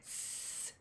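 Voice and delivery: "S" as a long soft sss; voiceless, sustained snaky sss; snake hiss. Shown as a demonstration. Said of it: "like a snake".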